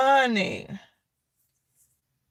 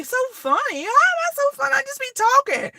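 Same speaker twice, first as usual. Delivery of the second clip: mock disbelief; 11 semitones higher, faster than usual. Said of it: "I say".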